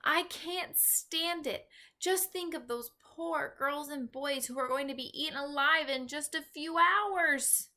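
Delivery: put-on girl crying out in distress. A narrator's reading. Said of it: "she stood up and cried out".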